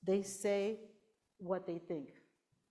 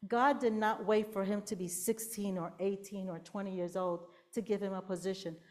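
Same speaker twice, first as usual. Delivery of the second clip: usual speaking voice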